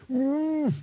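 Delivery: very high gagged whimper; muffled hum. A narrator's reading. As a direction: hum through a covered mouth. Gagged dwarf hums in alarm.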